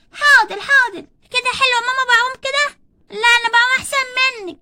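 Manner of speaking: put-on children boast to mother while swimming; eager kids. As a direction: show off swimming to mama.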